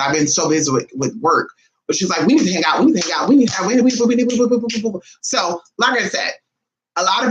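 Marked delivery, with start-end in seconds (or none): 2.3-5.0 rapid, babbled repetition trailing into nonsense; mock pestering. Cousin begging to hang out, mock pestering. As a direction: imitate someone nagging on a loop.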